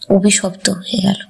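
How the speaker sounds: usual speaking voice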